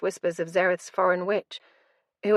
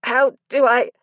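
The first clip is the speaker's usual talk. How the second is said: strained and louder, words catching in the throat; choked out; 5 semitones higher, 7 dB louder than usual. A narrator's reading. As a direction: force it out through a tight throat. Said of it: "I choked out".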